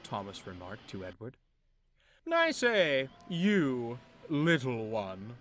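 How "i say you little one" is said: high, loud call, then a slow patronising drawl; condescending call. Acted. Big engine summoning little Thomas, condescending. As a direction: beckon him like a servant. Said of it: "the big engine beckoned to Thomas".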